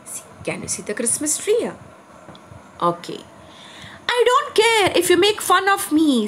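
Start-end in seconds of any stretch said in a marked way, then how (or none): 4.2-6.3 put-on higher, bolder young reindeer voice; voicing Rudolph. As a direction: blurt it out like a shy reindeer finding courage. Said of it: "said Rudolph suddenly".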